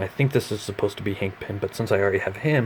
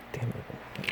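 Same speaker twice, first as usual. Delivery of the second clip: whispered curse; 2 semitones higher, 12 dB quieter than usual. Real, not acted. Figure keeps falling over.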